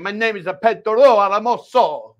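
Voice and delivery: rolled, hammed-up Spanish-style name intro; mock Latin accent. Reading a viewer's name, hammed-up Latin accent. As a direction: announce the name like a cheesy Latin host.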